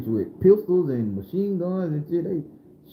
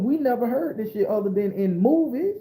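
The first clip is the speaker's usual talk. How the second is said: normal talking voice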